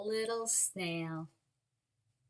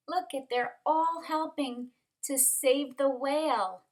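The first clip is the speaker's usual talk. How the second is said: usual speaking voice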